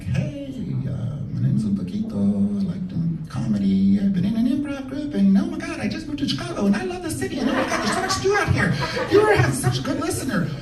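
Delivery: high, fast, breathless babbling; nervous chatter. Retold or imitated. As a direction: rattle on, nervous and coked up. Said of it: "And I'm like".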